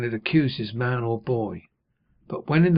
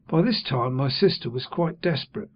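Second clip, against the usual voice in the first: ordinary delivery, nothing marked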